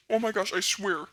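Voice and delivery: ordinary talk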